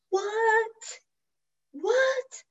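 high, drawn-out disbelief; high, incredulous questioning; astonished disbelief. Retold or imitated. Astonished reaction to sponsor's insight.

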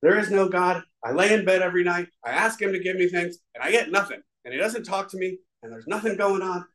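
mock-furious rant at God, half reliving it; retold angry rant. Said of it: "I just go off on him".

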